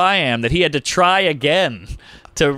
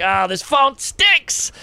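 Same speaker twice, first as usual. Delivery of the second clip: slow groan; 10 semitones higher, much slower than usual. Retold or imitated. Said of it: "I'm like".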